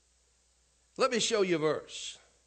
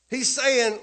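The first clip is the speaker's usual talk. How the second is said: ordinary delivery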